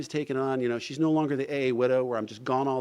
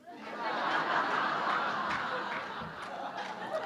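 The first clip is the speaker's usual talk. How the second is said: long breathy burst of laughter; long high laugh; 8 semitones higher, 5 dB quieter than usual. Real, not acted. Laughing at the news that he has to move to Texas, long breathy laugh. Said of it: "So I started laughing".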